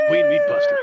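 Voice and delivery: booming gleeful showman yell; big shout. Acted. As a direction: shout it out like a game show host springing a trap. Host drops contestant to shark.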